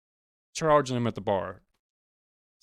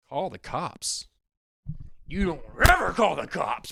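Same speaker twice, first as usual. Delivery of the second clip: biker's outrage; 6 semitones higher than usual. Retold or imitated. Biker yells at the promoter before punching him.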